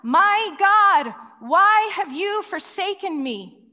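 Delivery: a desperate shout of abandonment; loud anguished cry. Acted. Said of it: "cries out with a loud voice".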